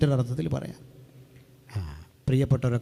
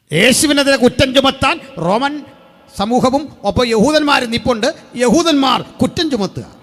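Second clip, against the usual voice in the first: fiery preaching; 11 semitones higher, 11 dB louder than usual. Acted. Preacher proclaiming the Passion to his congregation at full force. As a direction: thunder it out from the pulpit.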